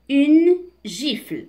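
deliberate, emphatic word-by-word reading; slow model reading. Shown as a demonstration. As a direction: announce the word slowly for learners. Slow model reading, then explained.

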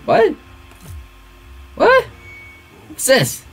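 high squeak of disbelief; very high, incredulous yelp; baffled. Real, not acted. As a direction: squeak it out, rising each time. Baffled by the error code.

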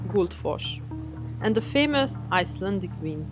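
usual speaking voice

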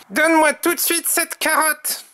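high-pitched insistent order; commanding Tom. A narrator's reading. Tom orders the rabbit to hand over the carrot, high commanding voice. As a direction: demand it high and firm. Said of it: "ordonne-t-il".

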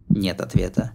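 ordinary delivery

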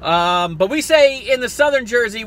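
ordinary talk, nothing marked

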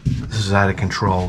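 soft appalled mutter; quiet dismay. Retold or imitated. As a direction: mutter it at your own shaking belly. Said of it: "I said".

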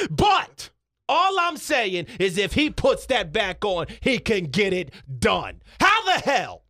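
slow, emphatic pundit build-up; Stephen A Smith. Acted. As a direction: punch the key words, rising to indignation. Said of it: "Stephen A Smith".

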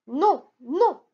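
non lancé plus fort; refus ferme. A narrator's reading. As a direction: couper court à la supplique. (génie qui refuse d'un non sonore)

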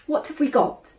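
high, eager question; interested. A narrator's reading. Hungry boy asking his sister eagerly about food. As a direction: perk up at the mention of food.

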